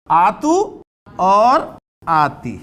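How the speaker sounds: very slow आतू और आती with long vowels; drawn-out endings. Shown as a demonstration. Teacher to students, drawing out आतू and आती very slowly. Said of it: "तो sound".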